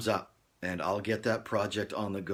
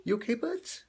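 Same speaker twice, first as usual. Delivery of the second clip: high-pitched, tender question to a pet; high little voice for an animal; cooing pet check; 13 semitones higher than usual. Real, not acted.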